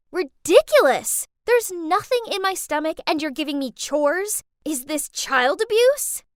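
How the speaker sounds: put-on loud indignant teen; yelling back. Teen yelling back at mom over dinner, loud indignant protest. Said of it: "I yelled back".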